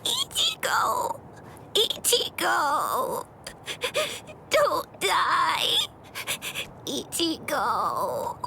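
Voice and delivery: drawn-out, sobbing calls to the dying; tearful cries. Acted. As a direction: wail his name in anguish. Crying over wounded friend.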